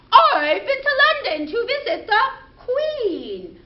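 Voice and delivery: chirpy kitty answering proudly; pussycat puppet voice. Pretend. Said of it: "our friend the pussycat".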